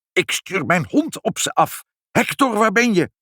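high-pitched angry threat; choking with rage. A narrator's reading. Pinkepank setting his dog on the cats, high enraged call. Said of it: "stikkend van woede".

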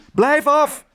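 high, loud warning yell; high-pitched angry shout. A narrator's reading. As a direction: yell to fend off a grabbing rival. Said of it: "schreeuwde de ander".

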